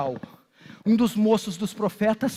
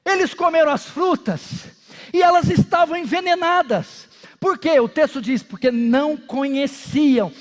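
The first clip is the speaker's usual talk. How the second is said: raised, insistent sermon delivery; impassioned pulpit retelling; forceful preaching; 3 semitones higher than usual. Real, not acted. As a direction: drive each point home to the congregation.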